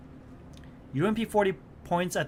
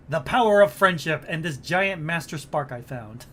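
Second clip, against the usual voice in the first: hammy triumphant; 7 dB louder than usual. Acted. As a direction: announce it like an anime hero. Hammed-up triumphant joke line.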